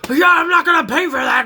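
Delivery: loud, high indignant put-on voice; hammed-up outraged driver; loud mocking voice of the truck owner; mock angry truck guy. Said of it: "was like".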